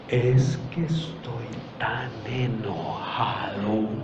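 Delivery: very slow, low and soft; slow motion. Shown as a demonstration. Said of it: "airarse en cámara lenta".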